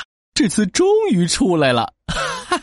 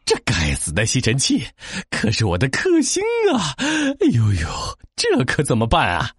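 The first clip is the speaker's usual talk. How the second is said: scared. Acted. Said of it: "吓得全身发抖".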